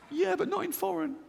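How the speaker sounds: high, panicky inner voice blurting it out; a squeaky scared voice in his head; fearful inner voice. Retold or imitated. Comedian voicing the scared side of his mind, high and panicky. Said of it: "This one goes".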